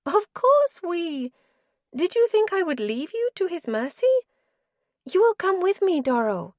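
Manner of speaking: wife's voice. Acted. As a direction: she refuses him, tired and final. Wife's voice again.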